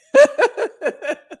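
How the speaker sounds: high laugh. Real, not acted. Laughing to viewers about a copycat, high.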